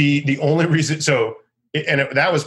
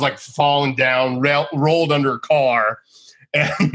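ordinary talk

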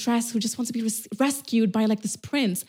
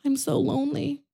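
higher, softer whiny self-pity; mock a lonely girl wallowing; parodying a self-pitying lonely woman, much softer; 5 semitones higher, 7 dB quieter than usual. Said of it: "not do the whole, like".

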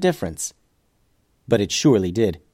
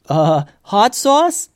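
hesitant call; 7 semitones higher, much slower than usual. Acted. Tim calls out mid-fight.

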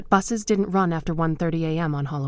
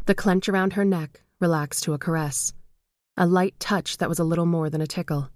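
usual speaking voice

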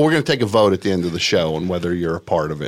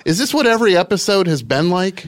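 exasperated; 11 semitones higher, 6 dB louder than usual. Real, not acted. Host exasperated at show.

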